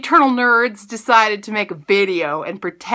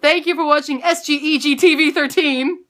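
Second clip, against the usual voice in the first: higher-pitched lightly played presenter sign-off; mock TV reporter; 7 semitones higher than usual. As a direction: imitate a kid playing TV reporter. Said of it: "I'm basically Ma-, Miss Reporter Lady".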